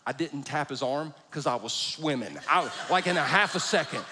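ordinary talk

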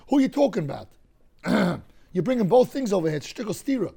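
ordinary talk, nothing marked

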